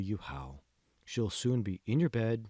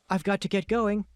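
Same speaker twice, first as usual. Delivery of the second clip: wife's voice; 8 semitones higher, 5 dB louder than usual. A narrator's reading. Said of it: "Felicia said as she reached".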